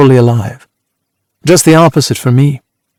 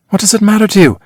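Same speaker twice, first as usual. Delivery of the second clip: sharp, higher irritated question; 8 semitones higher than usual. A narrator's reading. Annie, irritated, rebuffs the narrator's question. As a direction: snap it back, annoyed.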